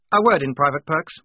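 officer sharply cutting in; snapped order. A narrator's reading. Lieutenant interrupting Polly, snapping. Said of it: "snapped Blouse".

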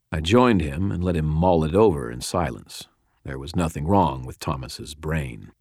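usual speaking voice

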